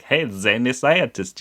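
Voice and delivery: lightly put-on harmless scientist voice; harmless character. Podcaster imitating the actor's early harmless scientist act, lightly put-on accent. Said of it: "he's this harmless, like".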